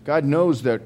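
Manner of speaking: ordinary talk, nothing marked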